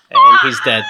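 cracking up mid-line; words squeezed out through laughter; laughing. Real, not acted. Laughing at own muffin joke.

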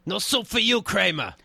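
loud, high Soup Nazi bark. Retold or imitated. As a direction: shout it like the Seinfeld soup chef. Quoting a Seinfeld catchphrase.

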